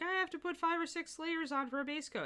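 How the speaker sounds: high, whiny quoting of a fed-up painter; mock customer complaint. Artist quoting customers who over-layer, mock whiny. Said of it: "people who contact me who are like".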